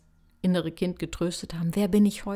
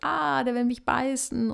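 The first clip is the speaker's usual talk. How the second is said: put-on panicked child; 6 semitones higher than usual. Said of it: "schreit und sagt".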